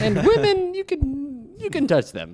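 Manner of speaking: high mock Italian accent; a high, hammed-up Italian accent; Italian president. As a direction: play the Italian president with a grin. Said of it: "Are his policies Italian?".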